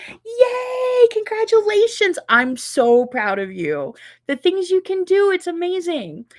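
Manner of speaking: high, loud, thrilled cheer; excited cheering. Real, not acted. Cheering a viewer for finishing her first long-arm quilt, high and excited. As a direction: cheer her on, full of delight.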